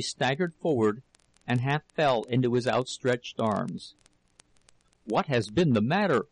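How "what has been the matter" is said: ordinary delivery, nothing marked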